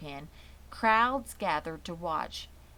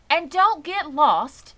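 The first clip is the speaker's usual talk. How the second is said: higher, louder and slow, a fussy aunt; fussing motherly warning; 9 semitones higher, 12 dB louder, slower than usual. A narrator's reading. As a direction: drag it out, fussing over the kids. Mrs. Zuckerman fussing.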